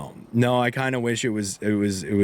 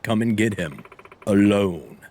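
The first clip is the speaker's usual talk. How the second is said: ordinary delivery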